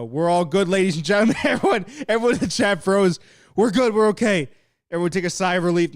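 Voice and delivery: laughing mock announcement to the chat; hammed-up all-clear. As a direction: play it like a ringmaster telling the crowd it's fine. Mock announcement to chat after freeze.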